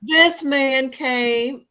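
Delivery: raising the voice as the story gets wild; excited telling. Real, not acted. Telling the live audience about the grocery store man, excited and loud.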